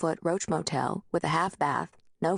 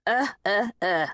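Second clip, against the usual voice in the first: higher mocking imitation of her stammer; 3 semitones higher than usual. A narrator's reading. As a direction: make fun of her stammering. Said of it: "he said, mocking me".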